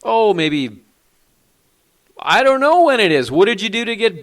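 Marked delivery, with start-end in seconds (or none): none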